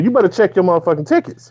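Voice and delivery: husband telling the rude woman to check her tickets; firm comeback. Retold or imitated. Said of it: "And I said it just like that".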